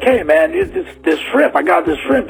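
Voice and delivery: imitated street seller, pitch leaping high; shrimp hawker. Scruffy stranger selling shrimp in his living room, mock sales pitch. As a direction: eagerly hawk it, voice jumping up, mock salesman.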